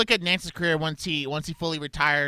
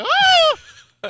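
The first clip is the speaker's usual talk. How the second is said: high, loud whooping imitation; imitated Raftery yell; 9 dB louder than usual. Co-host imitating the commentator's yell, high loud whoop. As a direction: mimic an announcer's excited holler. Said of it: "that yell he does".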